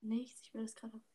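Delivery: unvoiced whisper; quiet whispered aside. Real, not acted. Hushed aside.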